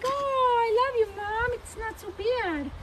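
high, sweet voice for the horse, slow at the end; horse's human voice. Pretend. Owner voicing her horse's thanks in a high, sweet voice. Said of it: "'Cause he talks like that, apparently, in his human voice".

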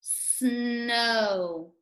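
snow with the s and long o drawn out; stretched out. Shown as a demonstration. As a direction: stretch snow, hold the s, then the o. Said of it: "Let's stretch it out".

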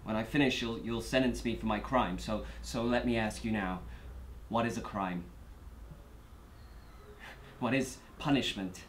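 low, very soft and halting; subdued. Acted. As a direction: begin quietly, weighing each question. Defendant begins courtroom statement, subdued.

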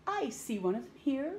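usual speaking voice

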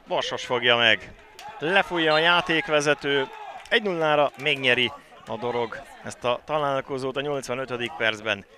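ordinary talk

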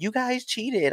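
high-pitched retold complaint; accusing heckler. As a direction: imitate an indignant fan's protest. Heckling woman accuses the winners.

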